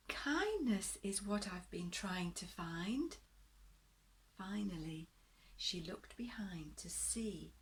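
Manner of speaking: gentle bedtime story reading, rising and falling; storybook narration. A narrator's reading. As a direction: read it like a picture book to little ones.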